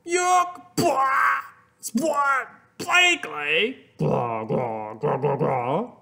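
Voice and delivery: high, mock-disgusted yelling. Christopher tasting awful cookie, mock disgust. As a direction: spit out the bad taste, hammed-up. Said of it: "And he yelled".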